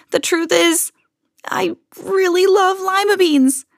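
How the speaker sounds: high-pitched, drawn-out confession; crying out. A narrator's reading. Camilla confessing her love of lima beans, crying out. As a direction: blurt it high, then stretch it out. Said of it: "she cried".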